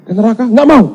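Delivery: high, loud mock dialogue; loud, high-pitched acted answers; emphatic acted reply. Preacher acts out emphatic answers.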